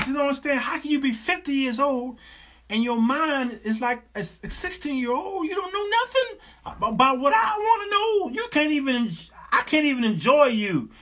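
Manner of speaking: high-pitched, hammed-up scolding; exasperated boyfriend. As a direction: scold your partner in mounting disbelief. Acts out exasperated man scolding older girlfriend.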